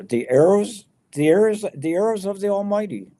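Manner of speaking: rising insistence on "the arrows"; emphatic repetition. Acted. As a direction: hammer the word "arrows" home. Repeating the phrase for emphasis.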